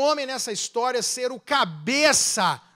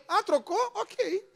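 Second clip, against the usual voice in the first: mock acceptance; 8 semitones higher, 5 dB quieter, slower than usual. Wife answering husband, acted.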